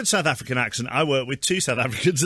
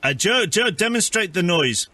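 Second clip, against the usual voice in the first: lightly put-on South African accent, deadpan; mock South African accent; 4 semitones higher than usual. Prank caller playing neighbourhood watch, mock South African accent. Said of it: "It was South African".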